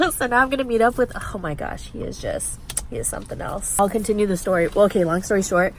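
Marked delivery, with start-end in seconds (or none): 1.2-3.8 soft whispered aside; hushed, whispered remark. Real, not acted. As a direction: whisper it so he can't hear. Hushed aside about boyfriend.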